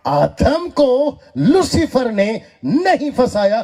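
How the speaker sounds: high, slow, emphatic preacher's declaration; bold proclamation. Real, not acted. Preacher defies received teaching on Adam and Lucifer, bold proclamation. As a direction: declare it boldly, spacing out each phrase. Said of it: "डंके की चोट पे बोलता हूं".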